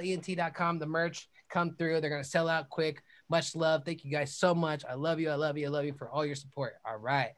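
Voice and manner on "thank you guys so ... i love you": ordinary talk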